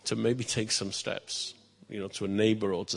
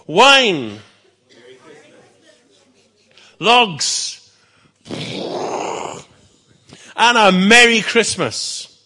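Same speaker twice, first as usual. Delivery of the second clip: huge high bellow; 10 semitones higher, 13 dB louder, much slower than usual. Acted. King bellowing the gifts to the peasant, full loud roar. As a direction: roar it out with joy. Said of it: "he bellowed".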